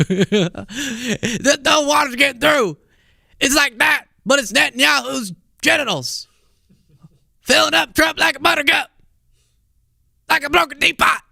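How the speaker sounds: mock rant. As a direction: yell it out like a raving host. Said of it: "that whole rant he went on".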